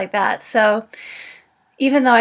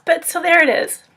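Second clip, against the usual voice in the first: emotional; 7 semitones higher than usual. Real, not acted. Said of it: "make me emotional".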